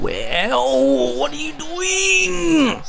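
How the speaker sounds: high, slow "what are you doing?"; exasperated. Real, not acted. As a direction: plead at the player in exasperation. Exasperated at a player.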